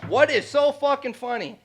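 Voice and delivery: high, loud imitated yell; mock yell. Imitating the guy's long yelling fit, high and loud. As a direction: bark it like the furious guy again. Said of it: "he yelled at me".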